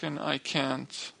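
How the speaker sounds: ordinary talk, nothing marked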